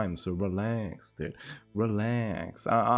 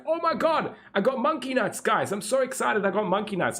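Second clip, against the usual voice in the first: very high, gushing fake excitement; mock thrilled gamer; 10 semitones higher than usual. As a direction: ham up a lucky find. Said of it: "he's like".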